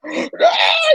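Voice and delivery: high-pitched mock shriek; daughter's horrified scream, imitated. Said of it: "they're all screaming".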